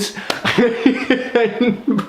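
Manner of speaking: high, helpless giggling; amused fit of high laughter; real laugh. Real, not acted. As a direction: crack up mid-story. Laughs at retold comeback.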